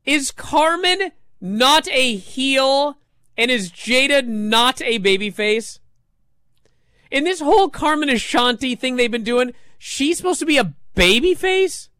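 incredulous. Real, not acted. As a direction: ask in rising, indignant disbelief. Incredulous questions about who is heel.

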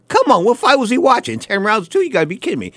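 high-pitched real disbelief; indignant, voice jumping up high; incredulous. Real, not acted. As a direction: scoff in disbelief at an absurd call. Scoffs at a judge's lopsided scorecard.

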